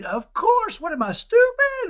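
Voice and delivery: put-on the eager kid scoffing at a silly question; sarcastic kid. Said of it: "He said".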